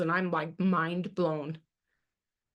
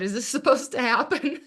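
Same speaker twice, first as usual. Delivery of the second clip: laughing question; 4 semitones higher than usual. Real, not acted. Laughing while retelling call.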